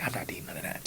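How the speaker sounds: hushed disgusted aside; whispered refusal. Retold or imitated. Runner muttering at buffet sauces, whispered disgust.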